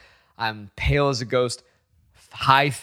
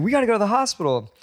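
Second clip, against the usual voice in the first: high, fast insistence; acted worried dad; alarmed urgency; 10 semitones higher, much faster than usual. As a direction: rush someone off to get help. Alarmed dad after seeing ankle.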